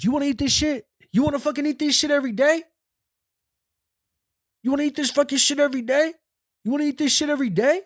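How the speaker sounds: very high, strained mock shouting; high-pitched retold tirade, volume held back; imitated yelling. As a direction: hound them like a yelling inmate. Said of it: "Why is he yelling at us?".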